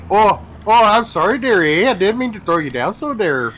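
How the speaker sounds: overly polite Canadian linebacker apologizing; mock Canadian. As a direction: ham up a polite Canadian sorry. Mock apology after sacking quarterback.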